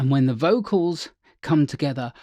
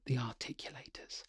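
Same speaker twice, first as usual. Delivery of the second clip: whisper; 2 semitones lower, 16 dB quieter than usual. Real, not acted. Unvoiced whisper.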